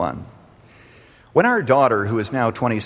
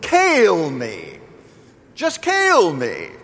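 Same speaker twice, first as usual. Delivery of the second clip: high, drawn-out Appalachian wail; hammed-up wail of a woman in labour, falling on each plea; imitated crying out; 9 semitones higher, much slower than usual. Young Appalachian woman in labour begging, imitated crying out. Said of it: "I kept hearing this woman".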